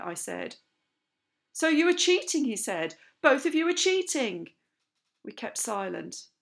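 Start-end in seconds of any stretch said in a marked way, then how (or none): 1.5-2.4 high, loud outrage; angry accusation. A narrator's reading. Captain accusing two pupils in loud anger. 3.2-4.5 high, loud outrage; angry accusation. A narrator's reading. Captain accusing two pupils in loud anger.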